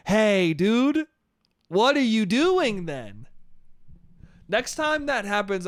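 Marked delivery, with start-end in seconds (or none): 0.1-3.3 raised, drawn-out challenge; mock confrontation. Confronting someone next time it happens, mock confrontation. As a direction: call someone out with playful exasperation. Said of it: "I'm just gonna go".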